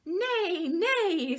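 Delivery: high, loud neighing nay; whinny it out like a horse. Acted. Parent reading to a child, voicing the horse's high neigh. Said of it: "said the horse".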